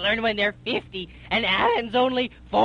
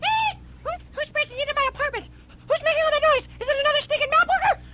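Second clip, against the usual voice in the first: high, fast, shrill-voiced woman; shrieking old woman; much faster than usual. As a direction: screech at the intruder at top speed. Rose shrieking at intruder.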